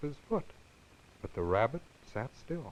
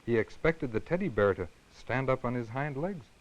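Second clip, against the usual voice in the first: ordinary delivery, nothing marked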